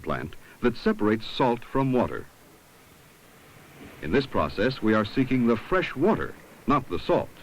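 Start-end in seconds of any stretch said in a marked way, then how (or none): none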